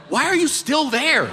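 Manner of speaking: high, incredulous question; exasperated disbelief. Retold or imitated. Comic to a friend who stayed near danger, exasperated disbelief. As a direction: ask it high, unable to believe it.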